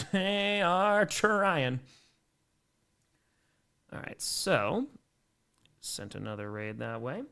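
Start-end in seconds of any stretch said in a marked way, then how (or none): 0.1-1.8 very slow, higher-pitched, stretched words; drawn-out emphasis. Real, not acted. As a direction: stretch every word right out with a laugh going in. Repeats phrase, drawn out for emphasis.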